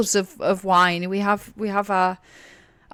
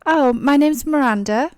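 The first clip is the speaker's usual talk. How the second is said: lightly voiced sweet girl; 4 semitones higher, 6 dB louder than usual. Girl introduces herself to Flynn.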